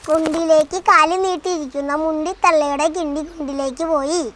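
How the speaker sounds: rattled off fast in one breath; fast tongue twister. Shown as a demonstration. Child rattling off a tongue twister for the parent, fast. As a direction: race through the tongue twister at speed. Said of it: "ഒന്നുകൂടി പറഞ്ഞേ".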